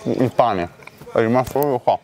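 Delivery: strained swallowing while talking; throat clogged with bread; food stuck in throat. Real, not acted. Seen 0: Blue-shirt man holds sandwich, hand on throat; chewing unclear.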